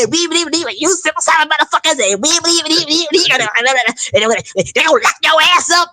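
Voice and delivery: mocking high-pitched, rapid stammering rant; hammed-up impression of an agitated high-voiced pundit; Roland Martin. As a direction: go off in a high, repetitive debater's squawk. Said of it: "he got that high pitched voice".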